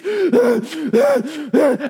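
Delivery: loud, heavy deer panting; hard gasping breaths, acted out; desperate panting. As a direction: pant hard like a fleeing animal. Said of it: "he's panting like this".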